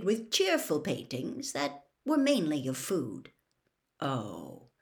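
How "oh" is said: low, soft, dawning realization; slowly. A narrator's reading. Harry halting halfway down the corridor, slow and low. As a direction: draw it out low and soft as it dawns. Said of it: "said Harry slowly".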